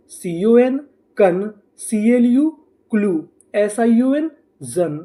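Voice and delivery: slow, code by code with small pauses; slow sounding out. Shown as a demonstration. Teacher to students, sounding out each code slowly. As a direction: spell each chunk out carefully. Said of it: "तो हमारी meaning क्या बनी?".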